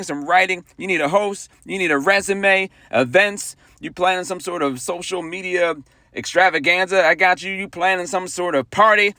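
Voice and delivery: high, eager sales pitch; ad read. Acted. Producer pitching creative services to the audience, hyped ad read. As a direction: sell it like a hype commercial.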